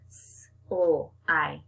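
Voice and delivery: Sl-i-c-e with each sound drawn out; split apart. Shown as a demonstration. Teacher breaks the word apart for the children. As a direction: stretch Sl-i-c-e into separate sounds. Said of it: "Break apart that sl".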